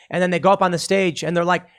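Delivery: ordinary talk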